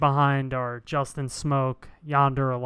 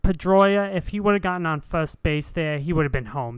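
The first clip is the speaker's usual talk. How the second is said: louder, laughing Boston homer voice; Boston broadcaster; 2 semitones higher, 3 dB louder than usual. Pretend. The Boston announcer gushing about Pedroia, mild Boston voice. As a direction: praise the local hero like a Boston announcer. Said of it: "you be him".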